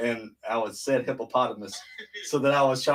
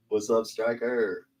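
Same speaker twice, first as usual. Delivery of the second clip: ordinary talk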